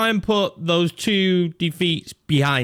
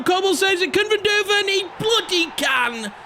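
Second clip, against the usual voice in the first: excited; 14 semitones higher than usual. Real, not acted. Manager celebrating his striker's goal with a high shout. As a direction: burst out as the rebound goes in. Goal scored after save.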